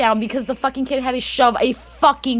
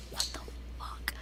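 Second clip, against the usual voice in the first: barely voiced, disbelieving whisper; hushed, appalled aside; stunned whisper; 22 dB quieter than usual. Stunned aside at son's answer.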